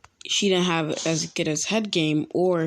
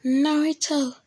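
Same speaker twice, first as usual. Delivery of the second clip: high-pitched swooning fangirl call; fawning girls; 10 semitones higher, slower than usual. Acted. Girls flocking round Naruto, high fangirl voice. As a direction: call his name in a much higher girly voice. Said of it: "This is where they be like".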